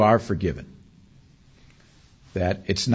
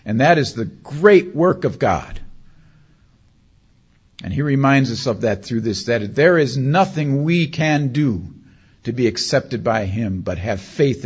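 raised preacher voice, leaning on key words; emphatic preaching; 3 semitones higher, 4 dB louder than usual. Real, not acted. Preacher telling the congregation of God's great work, emphatic. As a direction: proclaim it firmly, slowing toward the end.

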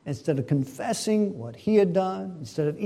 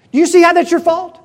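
loud, high, rapid blame; acted browbeating, voice raised; accusing question; 11 semitones higher, 13 dB louder, faster than usual. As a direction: pin the fault on him forcefully. Jezebel blaming Naboth, acted.

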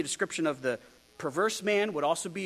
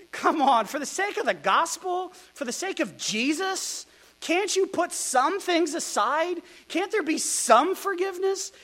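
higher, pleading appeal to feuding church women; pleading Paul; 9 semitones higher, 4 dB louder than usual. Retold or imitated. Apostle pleading with the church over two feuding women, higher and pleading. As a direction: beg them for unity, voice raised high. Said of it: "Paul has to write and say".

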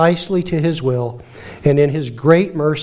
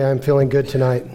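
usual speaking voice

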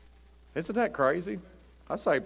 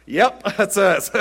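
slow, laughing, louder and higher; laughing quip; 5 semitones higher, 7 dB louder, slower than usual. Retold or imitated. Pastor joking to the congregation, laughing. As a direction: chuckle through the joke. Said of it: "I go".

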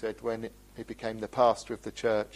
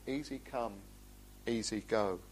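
usual speaking voice

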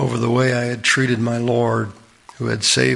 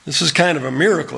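ordinary delivery

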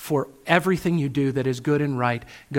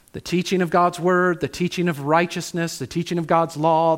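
ordinary delivery

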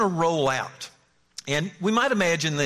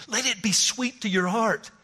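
ordinary talk

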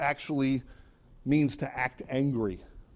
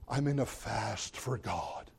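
slow, softer, gloomy for show; mock mopey; 4 semitones lower, 9 dB quieter, slower than usual. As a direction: sigh it for sympathy. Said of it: "so you'll say".

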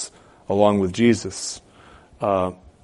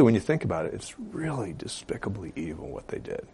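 ordinary talk

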